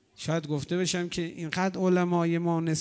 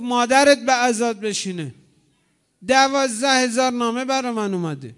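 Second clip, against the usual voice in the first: loud, higher, harsh scolding; angry rebuke; 4 semitones higher, 5 dB louder than usual. Retold or imitated. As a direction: lash out in a rebuke. Hurr angrily rebukes Imam Hussein.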